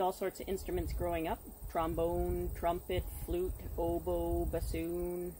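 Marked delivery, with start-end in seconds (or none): none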